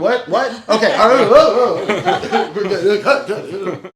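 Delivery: startled question; surprised what. Real, not acted. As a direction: react in disbelief. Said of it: "reacts to joke".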